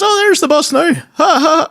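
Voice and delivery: put-on very high teasing old lady; giggly granny. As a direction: cackle it like a cheeky granny. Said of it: "And she goes".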